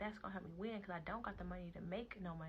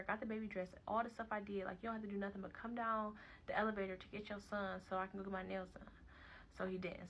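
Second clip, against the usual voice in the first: ordinary talk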